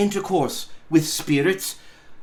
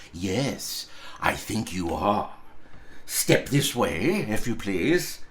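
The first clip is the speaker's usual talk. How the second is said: feigned stern boss; 7 semitones lower, 5 dB quieter, slower than usual. Acted. Scrooge lures Bob, feigning stern.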